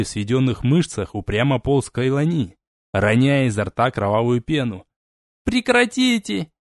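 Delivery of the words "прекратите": screaming. Acted. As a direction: scream at them to stop. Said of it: "кричала она".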